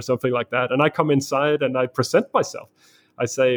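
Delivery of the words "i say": ordinary delivery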